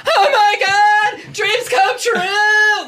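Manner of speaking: very high, loud fan shriek; drawn-out, over-the-top excited scream; ecstatic squeal. Retold or imitated. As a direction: scream it down the phone to a friend, reenacting pure glee. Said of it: "I remember calling her and be like".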